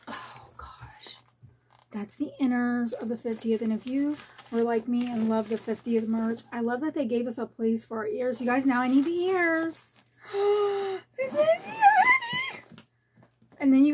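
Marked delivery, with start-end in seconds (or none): 0.1-1.2 awed hushed whisper. Real, not acted. Peeking at the lining. 10.1-12.6 much higher, very slow, loud squeal; ecstatic squeal. Real, not acted. Discovering the ears pocket.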